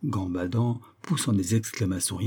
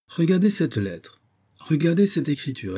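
pitched-up urging; higher, insistent appeal; urgent demand; 6 semitones higher than usual. Acted. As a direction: press them to look. Urges friends to examine the letter.

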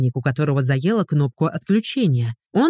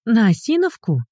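higher-pitched incredulous query; stunned question; 6 semitones higher than usual. A narrator's reading. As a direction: lift the pitch in astonishment. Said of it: "ошарашенно спросила дамочка". (ошарашенный вопрос)

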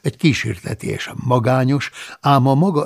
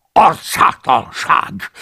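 loud, slow, outraged exclamation; raging; 8 dB louder, much slower than usual. Acted. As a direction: spit the word out in fury. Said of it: "dühöngött".

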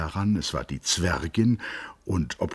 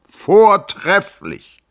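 much higher, louder, slow ringing cry; called out; 11 dB louder, much slower than usual. A narrator's reading. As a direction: stretch it out in a grand exclamation. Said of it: "rief Peeperkorn". (lautes, langgezogenes, hohes Ausrufen)

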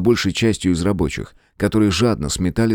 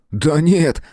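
high, quick-tempered denial; eager, high-voiced waving away; breezy dismissal; 9 semitones higher than usual. A narrator's reading. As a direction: brush the idea aside excitedly. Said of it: "отмахнулся Том".

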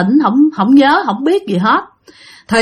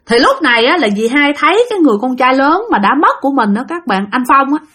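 ordinary delivery

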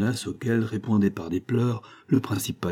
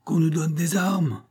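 restrained mock shout, stretching the last cry; crowd's cry; 7 semitones higher, slower than usual. A narrator's reading. Furious schoolboys calling for weapons, high crying voices. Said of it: "criant sur tous les tons".

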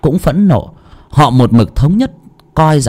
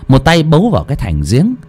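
usual speaking voice

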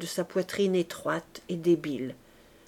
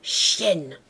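muttered insult; 3 semitones lower than usual. Acted. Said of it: "Et il ajouta".

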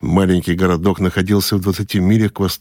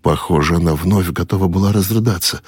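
usual speaking voice

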